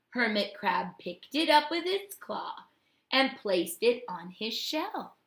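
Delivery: usual speaking voice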